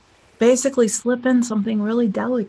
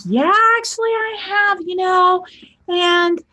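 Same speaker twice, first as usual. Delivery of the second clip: put-on eager young guy; 8 semitones higher, slower than usual. Voicing the young man's reply.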